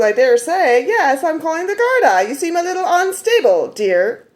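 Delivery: a hammed-up haughty comeback; mock haughty retort. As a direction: deliver it with smug faux-politeness. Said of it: "I didn't say it quite that way".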